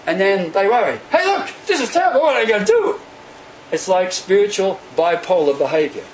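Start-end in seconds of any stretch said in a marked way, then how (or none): 1.1-3.0 high, fast, hammed-up alarm; a flustered bird suddenly fretting; mock panic. Migrating birds panicking, a fast high mock fret.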